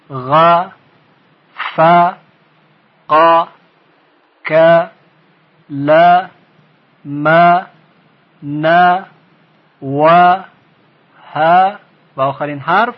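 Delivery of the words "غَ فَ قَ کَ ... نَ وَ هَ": غ، ف، ق each as a single short "a" syllable; short fatha letters. Shown as a demonstration. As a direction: give each letter a short a, then stop. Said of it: "باید زود صدا قطع بشه".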